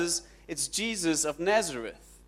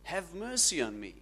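acted beggar's call, less than a full scream; screamed plea; 4 semitones higher than usual. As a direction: call out high, then soften on the plea. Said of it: "And he screams".

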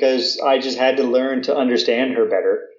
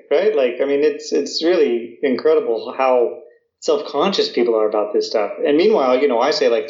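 ordinary delivery

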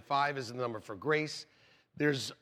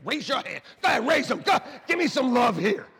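loud, rousing call to raise hands; 6 semitones higher, 7 dB louder than usual. Real, not acted. Preacher shouting to the congregation to cheer him. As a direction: hype up the crowd, shouting.

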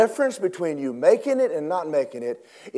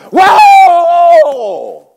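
sustained shout; 16 dB louder than usual. Acted. Preacher cries out in sermon.

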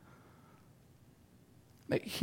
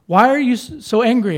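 loud, raised-pitch challenge; acted confrontation; 5 semitones higher, 7 dB louder than usual. Someone in a fight asks the angry person why, confronting. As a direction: throw the question out as if mid-argument.

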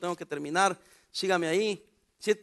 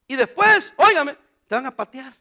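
emphatic louder warning; 4 semitones higher, 7 dB louder than usual. Real, not acted. Preacher pressing the congregation about getting kicked. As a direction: punch óigame loudly.

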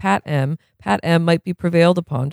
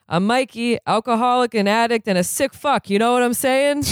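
Mikey's speaker voice; 7 semitones higher than usual. Acted. Mikey opens his AA speech.

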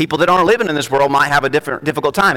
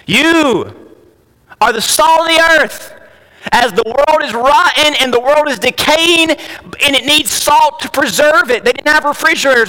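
preaching at full force; 6 semitones higher than usual. Acted. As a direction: thunder the scripture to the congregation.

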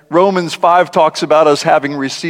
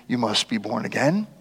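much softer, trailing up at the end; soft quoted verse; 7 semitones lower, 11 dB quieter than usual. Acted. Preacher quoting Jesus to Nicodemus, hushed and soft. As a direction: let the verse fall away gently.

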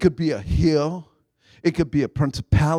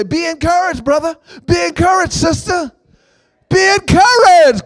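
higher and building louder to the last line; rising encouragement; 9 semitones higher, 5 dB louder than usual. Pretend. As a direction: cheer your neighbor on, growing each time. Telling neighbor be encouraged.

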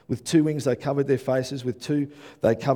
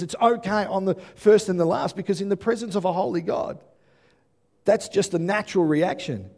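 higher and louder, driving the point home; impassioned preaching; 6 semitones higher, 5 dB louder than usual. Real, not acted. Pastor urging the congregation about God's love, impassioned preaching. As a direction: preach it with rising fervour.

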